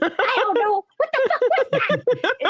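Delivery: high-pitched panicky retort; retold flustered outburst. Student answering the startled sleeper, high-pitched and frantic. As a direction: fling it back, high and flustered.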